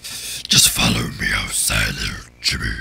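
very low, slow creature voice; monstrously deep, creepy spirit; scary Maria. Acted. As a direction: lure them out in a drawn-out demonic rumble. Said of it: "We should give Maria, like, a scary".

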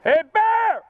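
high loud yell at a bear; scaring off a bear. Real, not acted. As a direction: shout to chase the bear off. Bear on trail, yelling.

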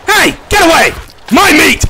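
high, loud shouts; angry yelling. Real, not acted. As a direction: yell to chase off a thief. Creature steals his meat.

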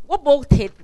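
ordinary delivery

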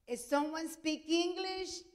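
slow, high, loud call to the crowd; doctor calling out, drawn out and loud; calling out to the room. Retold or imitated. Doctor calls out to waiting room.

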